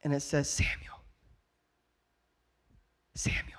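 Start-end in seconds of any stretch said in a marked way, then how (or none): 0.5-1.0 hushed, voiceless name-call; whispered call. Acted. Preacher as God calling sleeping Samuel, whispering. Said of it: "I'm gonna act this out". 3.1-3.5 whispered call. Acted. Preacher as God calling sleeping Samuel, whispering. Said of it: "I'm gonna act this out".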